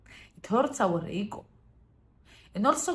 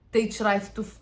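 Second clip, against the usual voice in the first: ordinary delivery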